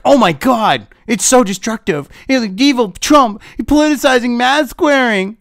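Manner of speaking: high-pitched mock horror; hammed-up impression of a scandalized pundit; mock outrage. YouTuber parodying a CNN editor's alarm at Trump, in mock outrage. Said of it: "he's trying to portray this as".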